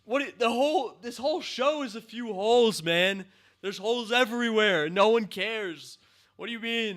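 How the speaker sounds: high, loud ranting; loud angry rant; exasperated rant. Real, not acted. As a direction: rant at the screen in disbelief. Ranting about plot holes.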